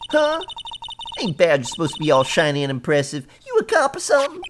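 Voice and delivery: high, punchy Southern country voice; suspicious Southern local. Acted. Southern woman questioning a lawyer's badge, suspicious. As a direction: challenge the stranger in a high Southern twang.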